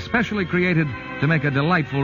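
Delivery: usual speaking voice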